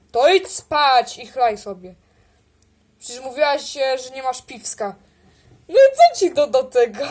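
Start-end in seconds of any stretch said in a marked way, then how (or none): 5.7-7.1 angry shout. Acted. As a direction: bite back hard at her. Kłótnia o piwo. (głośna, gniewna odpowiedź)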